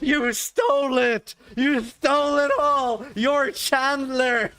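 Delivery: loud, high mock outrage, dragged out slowly; outraged accusation. Wife storming in to accuse him of stealing Chandler jokes, loud high mock fury. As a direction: shout it like you've just caught him red-handed. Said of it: "She storms into my room. She's like".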